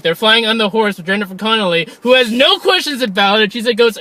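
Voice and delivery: usual speaking voice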